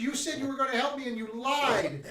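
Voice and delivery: put-on high, upset child voice; upset little girl. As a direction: scold them like a hurt kid. Girl character berates the party.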